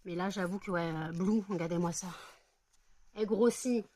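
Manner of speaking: usual speaking voice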